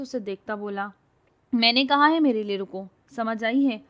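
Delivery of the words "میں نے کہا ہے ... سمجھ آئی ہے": loud heated retort; angry retort. A narrator's reading. Girl argues with the man, angry. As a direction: shove the words back at him.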